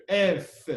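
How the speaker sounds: "If" alone, firm and weighted; stress on IF. Shown as a demonstration. Teacher repeating the stressed word, emphatic "If". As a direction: give "If" full force. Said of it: "you put a stress on, on if here".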